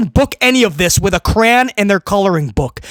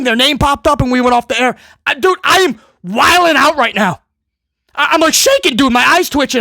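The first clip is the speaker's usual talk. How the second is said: mock excited fan; 2 semitones higher than usual. Fan yelling to his buddies after the show ends, hammed-up excitement. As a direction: gush like a fan who can't believe the ending. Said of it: "who ... is going".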